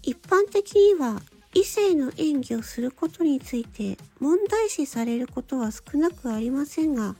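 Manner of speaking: normal talking voice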